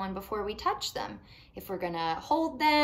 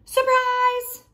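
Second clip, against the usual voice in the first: much higher, louder yell; shouting; 14 semitones higher, 10 dB louder than usual. Acted. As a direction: jump out and yell it. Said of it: "and shouted".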